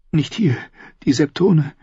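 high-pitched, hesitant protest; worried Cersei. Acted. Sister afraid the septons will catch them, high worried voice. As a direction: object anxiously in a high woman's voice.

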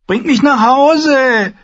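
put-on high, slow, insistent child voice; a small boy demanding to go home. A narrator's reading. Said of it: "verlangte Rickon".

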